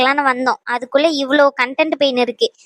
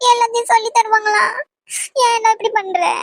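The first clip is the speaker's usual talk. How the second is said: higher, tearful exasperated complaint; crying in frustration; 4 semitones higher than usual. Acted. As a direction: plead through tears, pitched up. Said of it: "நீ எதுக்கு அழுவுற?".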